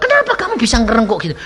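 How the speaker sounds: high, rapid incredulous question; acted baffled policeman; astonished questioning. Police question the collapsed criminal.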